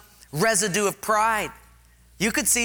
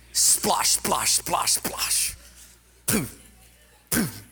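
splashing noise sounds; 3 semitones lower, much slower than usual. Retold or imitated. Mimics mud spit on eyes.

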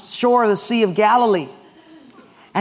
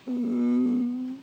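high, soft, held hum; uneasy hum; 4 semitones higher, 6 dB quieter than usual. Acted. Debtor asked by banker for money, humming uneasily. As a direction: stall with a sustained little "mm".

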